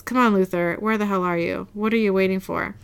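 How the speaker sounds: usual speaking voice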